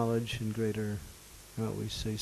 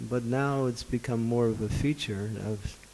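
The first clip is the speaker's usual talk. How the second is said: ordinary talk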